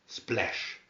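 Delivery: plain reading voice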